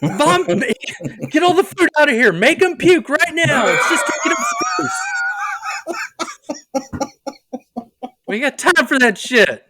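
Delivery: high, loud shouted commands; hammed-up commander yelling orders; mock battle orders. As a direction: bark orders like a panicked captain.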